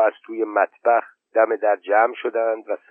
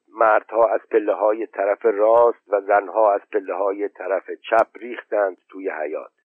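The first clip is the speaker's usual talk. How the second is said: ordinary delivery, nothing marked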